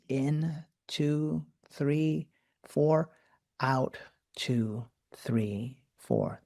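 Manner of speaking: slow even count of breathing; counting breath rhythm. Shown as a demonstration. Breathwork guide counts the breathing rhythm slowly. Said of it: "nice smooth rhythm".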